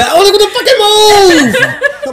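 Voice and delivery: high, drawn-out comic shout; hammed-up screaming at someone to move; mock furious yell. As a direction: yell it way up high like you're clearing a crowd. Acting out shoving through a crowd.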